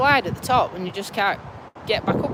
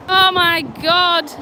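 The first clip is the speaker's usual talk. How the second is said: high shocked gasps and laughter; nearly fell in, shaken and laughing; 6 semitones higher than usual. Real, not acted. Nearly slipped into river.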